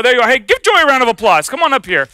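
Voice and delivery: ordinary talk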